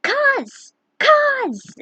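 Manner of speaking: high, loud screamed wake-up call; screaming. A narrator's reading. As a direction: scream her name to wake her. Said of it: "Riku came screaming".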